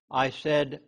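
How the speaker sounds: usual speaking voice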